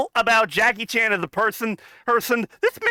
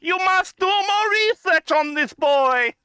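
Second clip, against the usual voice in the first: high, slow put-on uncle voice; 9 semitones higher than usual. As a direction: scold slowly as the uncle, pitched way up. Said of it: "let D L do the uncle voice".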